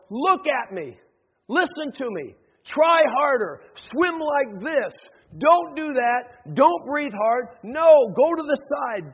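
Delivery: loud, high, insistent commands; pushy coaching from the beach; shouted instructions. Acted. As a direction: call orders out to a struggling swimmer. Other religions shouting orders at swimmer.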